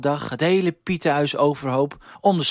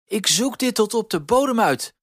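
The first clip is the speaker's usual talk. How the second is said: high, louder determined Piet; 11 semitones higher, 4 dB louder than usual. A narrator's reading. Huispiet vows to solve the mystery, determined. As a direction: say it with resolve, pitch way up. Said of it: "zei de Huispiet vastberaden".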